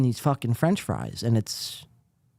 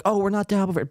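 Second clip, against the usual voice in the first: mocking whiny voice; 6 semitones higher, faster than usual. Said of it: "grinds my gears when they're like".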